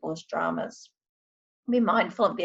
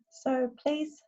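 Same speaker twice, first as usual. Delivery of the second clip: guided meditation; 6 semitones higher, 4 dB quieter than usual. Acted. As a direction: ease them gently into calm. I'm going to now do a short meditation.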